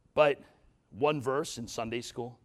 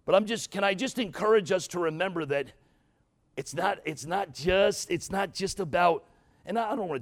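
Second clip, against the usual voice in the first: preaching at full force; 7 semitones higher, 7 dB louder than usual. Real, not acted. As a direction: drive it home to the congregation. Preaching climax.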